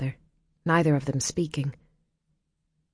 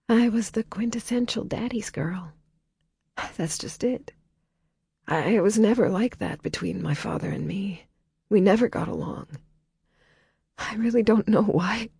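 wobbly voice; 6 semitones higher than usual. A narrator's reading. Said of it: "Her voice wobbled".

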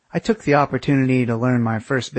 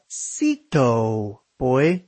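mock Italian; much slower than usual. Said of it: "Whatever the Italian word for sit is".

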